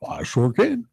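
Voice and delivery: high, dainty falsetto answer; a Dustin Hoffman as Tootsie impression; Tootsie-style voice. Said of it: "You sound like Dustin Hoffman in that movie".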